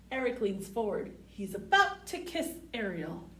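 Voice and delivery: clear, sing-song storybook read; picture-book read-aloud voice; reading to children. Acted.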